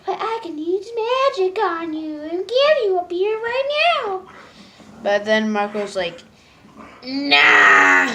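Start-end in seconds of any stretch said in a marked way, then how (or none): 0.1-4.2 much higher bubbly cartoon girl; Star voice. Star offering Marco magic, high bubbly girl voice. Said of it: "Star's like". 7.0-8.1 shouted higher nah; loud refusal. Retold or imitated. Marco refusing Star's magic, loud shout.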